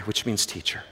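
normal talking voice

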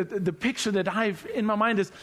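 ordinary delivery

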